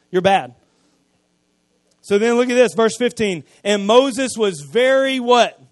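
preaching at full force. Acted. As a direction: rouse the congregation with a loud call. Preaching, calls to congregation.

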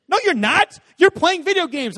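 high, loud exasperated rebuke; scolding dad. Retold or imitated. Dad catching son still gaming, high loud scolding. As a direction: call him out, loud and high.

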